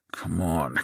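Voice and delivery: soft, under-the-breath plea; muttered urging. A narrator's reading. Driver urges the car on, muttering. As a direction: urge it along quietly.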